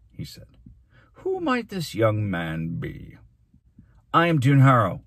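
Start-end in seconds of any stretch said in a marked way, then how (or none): 1.1-3.2 slow, drawn-out question; measured menace. Acted. Mayor asking the teacher about the boy, measured menace. 4.1-5.0 loud, raised self-announcement; defiant. Acted. Boy answering the glaring mayor, defiant.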